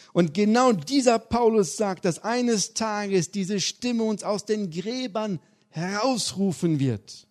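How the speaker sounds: high, loud preaching, slowing at the end; full-force preaching. Acted. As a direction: build it up like a pulpit climax. Preaching climax.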